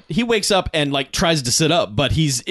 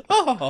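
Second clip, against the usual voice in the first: high, drawn-out "oh" of a tumble; comic falling wail, pitched way up; mock pratfall cry; 10 semitones higher than usual. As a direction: imitate someone toppling over backwards. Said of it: "does this pratfall of just".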